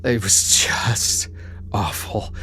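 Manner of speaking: breathless whisper of despair. Real, not acted. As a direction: whisper it, barely voicing the words. Tells of despair, voice drops.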